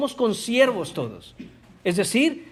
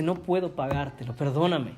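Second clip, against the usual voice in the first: softer, humble plea for mercy; quiet pleading; 5 semitones lower, 5 dB quieter than usual. Acted. Debtor servant begging his fellow servant, quiet plea. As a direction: beg quietly, head bowed.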